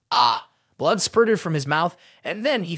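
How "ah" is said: sharp low cry of pain; short pained yelp as he is slapped. A narrator's reading. Bald man slapped hard, crying out in pain. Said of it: "cried out in pain".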